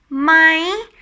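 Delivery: "My" high and lifting at the end. Shown as a demonstration. Teacher showing the question word, high tone "My". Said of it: "with high tone".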